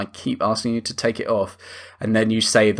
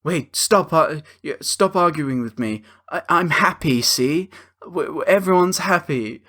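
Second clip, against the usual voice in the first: high, childish mock cheer; mocking the husband; 4 semitones higher than usual. Husband placates his wife with the mood ring, high and childish. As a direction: chirp it high like an overeager child. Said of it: "he's like".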